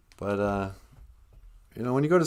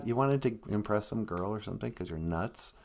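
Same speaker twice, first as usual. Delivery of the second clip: usual speaking voice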